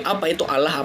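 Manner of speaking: ordinary talk, nothing marked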